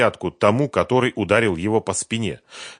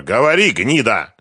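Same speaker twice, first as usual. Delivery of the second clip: loud, insulting bellow with raised pitch; yelling; 5 semitones higher, 9 dB louder than usual. A narrator's reading. Фёдор forcing the captive to talk, yelling. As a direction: yell it as a furious order. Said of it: "заорал Фёдор".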